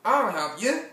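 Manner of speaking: high, loud rooster call. Acted. Rooster passing by offers Berlioz help, high loud character voice. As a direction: crow the offer out loudly. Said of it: "said a rooster".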